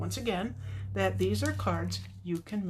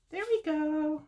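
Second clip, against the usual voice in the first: high, drawn-out singsong; playful singsong; 9 semitones higher, 3 dB louder, slower than usual. Real, not acted. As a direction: sing it out high in playful triumph. Playful repeat after a small success.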